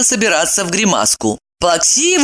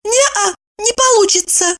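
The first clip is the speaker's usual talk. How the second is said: objecting. Acted. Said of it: "возразила".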